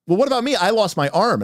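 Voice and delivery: high mock whine about a lost arm; whiny wizard. Wizard player complaining to the GM, high mock whine. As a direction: play the aggrieved player pleading to the GM. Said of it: "And then the wizard goes".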